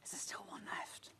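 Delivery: breathy hushed whisper. Real, not acted. Presenter whispering over the sold-out fabric kit. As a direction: whisper it as if not to disturb. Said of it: "Don't know why I'm whispering".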